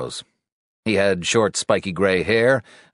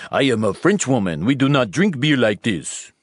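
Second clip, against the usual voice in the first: hammed-up French woman, raised pitch; mock French woman, higher and overdone; bad French accent; 6 semitones higher than usual. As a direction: put on a terrible French accent. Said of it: "That is the worst accent".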